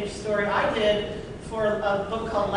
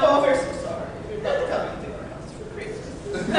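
apologetic trolls; 6 semitones higher than usual. Retold or imitated. Voicing the frightened trolls.

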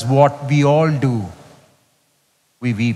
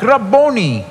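lifted, ringing cry of the name; cried out; 7 semitones higher than usual. Acted. Mary recognising her teacher, a raised cry. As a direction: call out the name with a lift. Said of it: "cried out in Aramaic".

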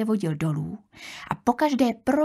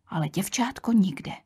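usual speaking voice

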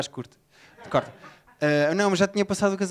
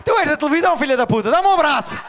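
put-on much higher, much louder yell of a drunk across the station; drunk shouting; 10 semitones higher, 5 dB louder than usual. Drunk stranger yelling to the comedian across the station, full shout. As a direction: bellow across four platforms, drunk and delighted. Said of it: "diz assim".

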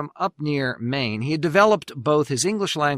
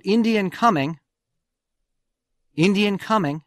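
retold alarm shout; 6 semitones higher than usual. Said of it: "They shouted out".

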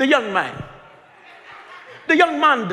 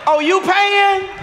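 mock surprise; 8 semitones higher, 3 dB louder, slower than usual. Mocking someone caught short.

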